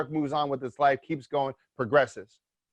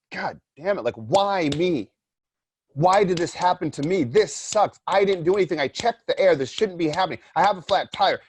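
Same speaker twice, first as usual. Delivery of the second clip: higher, louder exasperated complaining; mock frustrated rant at a flat tire; frustrated driver; 5 semitones higher, 5 dB louder than usual. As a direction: vent like a stranded driver slamming the wheel. Frustrated driver voiced in story.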